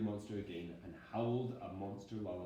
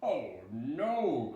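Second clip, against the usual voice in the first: dismayed cry; 4 semitones higher, 8 dB louder than usual. Acted. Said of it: "cried Daddy Monster".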